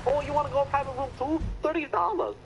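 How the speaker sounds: high-pitched mock Cambodian accent; imitated Cambodian accent. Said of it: "a much more thicker fucking accent".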